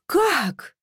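high, stunned gasp of a word; gasped disbelief. A narrator's reading. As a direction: gasp it out, aghast. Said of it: "ахнула я".

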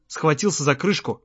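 plain reading voice

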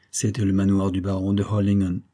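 ordinary delivery

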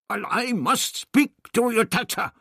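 put-on sharp, high old man snap; snapping. A narrator's reading. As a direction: snap at the guard impatiently. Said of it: "the doctor snapped".

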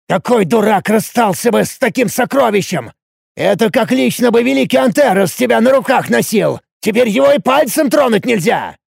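high, exasperated outburst; взвинченно. A narrator's reading. Взвинченный спор о кольце. (взвинченно, с досадой)

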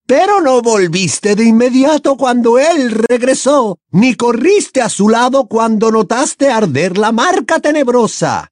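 high, loud accusing female villain; piercing, forceful challenge in a high character voice; Bellatrix, indignant. A narrator's reading. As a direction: hurl accusations in a shrill-pitched witch voice.

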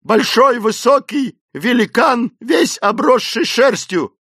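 very high, slow wail; wailing cries. A narrator's reading. Esmeralda describing the hairy giant to the men, wailing. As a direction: wail out each word in horror. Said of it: "выкрикивать причитания".

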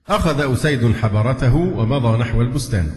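ordinary talk, nothing marked

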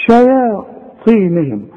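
شياطينهم drawn out slowly, light ya; slow tajweed demo of شياطينهم; slow recitation. Shown as a demonstration. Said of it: "أمال قلنا ليه شيا".

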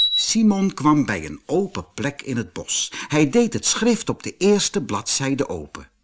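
ordinary delivery, nothing marked

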